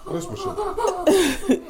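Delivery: high, slow, laughing protest; squeaky giggling complaint. Real, not acted. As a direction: drag it out high through laughter.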